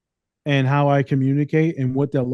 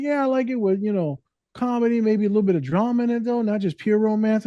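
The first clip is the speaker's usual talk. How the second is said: higher client voice; 8 semitones higher than usual. Retold or imitated. Voicing client's book taste.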